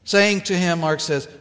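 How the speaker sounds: ordinary talk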